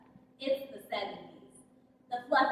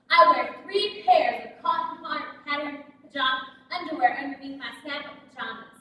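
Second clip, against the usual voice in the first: ordinary delivery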